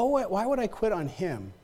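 normal talking voice

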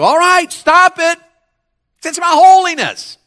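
high, loud call to the crowd; rally them to respond; preacher shouting at the pews. Real, not acted. Urging the congregation on.

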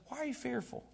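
soft, high acted question; gentle questioning. Acts out Jesus softly questioning disciples.

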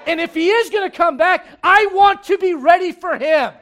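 rising excitement; fired up. Retold or imitated. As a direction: get stirred up. Congregant's roused inner voice.

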